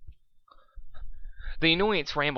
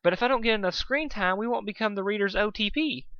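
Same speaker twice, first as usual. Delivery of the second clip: high Pinkie protest; 9 semitones higher than usual. Acted. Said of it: "she protested".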